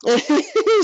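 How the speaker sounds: a loud burst of laughter, pitched high. Real, not acted. Recalling the nun beside her, a loud high laugh.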